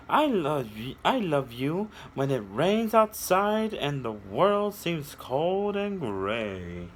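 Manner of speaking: sing-song read-aloud, slowing on the last line; storybook reading. A narrator's reading. Reading a picture book aloud to children, sing-song storytelling.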